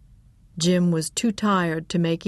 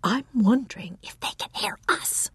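whispering; 4 semitones higher than usual. Acted. Fear of being overheard.

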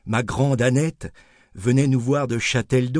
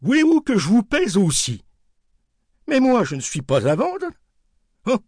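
higher bantering wholesaler voice; playful dealer; 8 semitones higher than usual. Retold or imitated. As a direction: tease the old woman about her weight. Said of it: "il ajouta par jeu".

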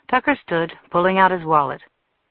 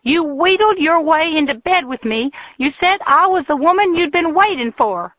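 shrill, much higher furious shout; furious woman; 11 semitones higher, 5 dB louder than usual. A narrator's reading. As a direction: hurl it at him, loud and high. Jilted woman rages at Tucker.

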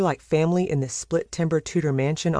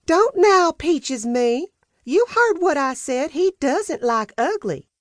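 Peaches putting her foot down; 12 semitones higher, slower than usual. Acted. Housekeeper cutting off Sam's protest, high and stern. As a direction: cut her off, then spell the rule out slowly. Peaches voiced in the dinner scene.

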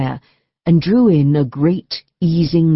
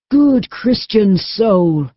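Hugh, grateful; 5 semitones higher, much slower than usual. A narrator's reading. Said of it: "said Hugh".

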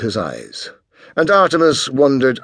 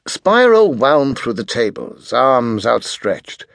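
plain reading voice

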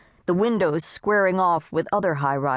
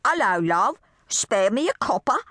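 an exaggerated mock English beggar accent; a bad English accent, begging, rising higher; 6 semitones higher than usual. Becky shows Annette a mock English beggar in a bad accent. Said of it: "That's how all British talk".

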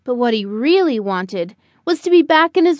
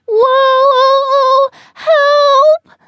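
high, loud drawn-out wail for help; crying out; 11 semitones higher, 7 dB louder than usual. A narrator's reading. Buddy falling from the tree, crying out in panic. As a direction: call out like a little bird falling. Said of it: "Buddy cried as he went down".

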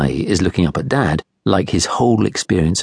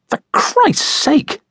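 very high, drawn-out exasperated cry; a petty man making a huge deal; slow, pitched-up indignant exclamation; exasperated outburst; much slower than usual. A narrator's reading. Guy exasperated over spill.

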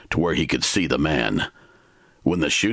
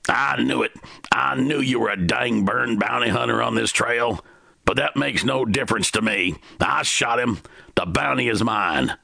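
gruff stranger, higher and sure of himself; the man, a hint of menace; asserted; 8 semitones higher than usual. A narrator's reading. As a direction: lay claim to the bounty. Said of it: "the man asserted".

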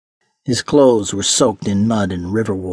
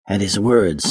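ordinary delivery

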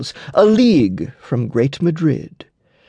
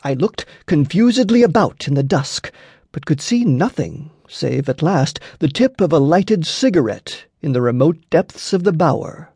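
plain reading voice

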